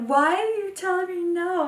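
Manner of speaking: high, loud whiny wail of protest; mock crying toddler. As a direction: imitate a child bawling at being refused. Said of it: "he starts to cry".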